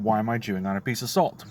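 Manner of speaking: ordinary talk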